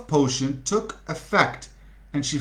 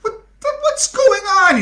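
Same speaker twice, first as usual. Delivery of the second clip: very high confused cry; baffled; 5 dB louder than usual. Acted. Bottom baffled as his friends flee, bewildered. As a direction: sound totally bewildered. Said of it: "he said".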